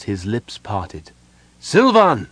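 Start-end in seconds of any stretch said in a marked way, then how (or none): 1.6-2.3 high, loud shout of a name. A narrator's reading. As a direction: shout it right into his ear. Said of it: "Sama shouted into the young man's ear".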